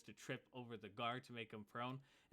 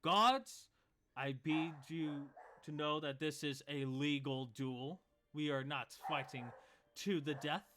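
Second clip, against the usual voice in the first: grand announcement; 3 semitones higher, 6 dB louder than usual. Acted. Half-orc proclaims to the guards that the duel is legal, loud. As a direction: declare it like a herald.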